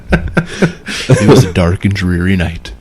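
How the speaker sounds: slow, low spooky storyteller. Acted. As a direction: start a ghost story, slowly and low. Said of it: "This is theater of the mind".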